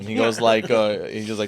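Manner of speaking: normal talking voice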